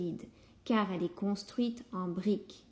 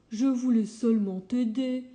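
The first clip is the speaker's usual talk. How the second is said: a touch of hurt in the wolf's voice; understated disappointed reply; mildly sad kind wolf; sadly; 2 semitones higher, 4 dB louder than usual. Acted. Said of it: "dit le loup tristement".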